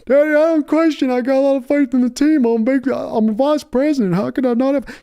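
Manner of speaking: high, whining grown son pleading; a weak son whimpering to Daddy; whiny son. Acted. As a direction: son whining back at his bullying father. Son Stephen whining to father.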